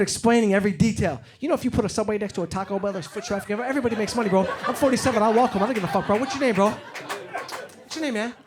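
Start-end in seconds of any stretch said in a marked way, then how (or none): 1.4-8.3 rambling cokehead. Retold or imitated. Comic to crowd, imitating a wired cokehead who won't stop talking. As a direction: pitch a business idea nobody asked for.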